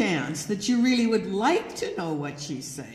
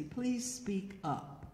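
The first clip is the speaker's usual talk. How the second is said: soft, slow, polite request; very nicely; 10 dB quieter, slower than usual. Shown as a demonstration. Man nicely asking her to speak up, soft and slow. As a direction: ask gently, taking your time. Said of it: "Just very nicely".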